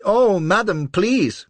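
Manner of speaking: high, loud, desperate plea; crying out. A narrator's reading. Little man begging Lola, high and loud. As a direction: beg with both hands. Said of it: "cries the little chap".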